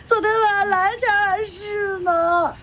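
sobbing through the words; tearful confession. Acted. A young woman tells the old aunt what happened, tearful. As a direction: confess it slowly, crying.

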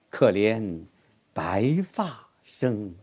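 soft, very slow, 白发 drawn out; stress on 白发. Shown as a demonstration. Teacher answers student on stress, 白发 slowly weighted. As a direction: pause after 可怜, then stretch 白发. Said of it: "重音是".

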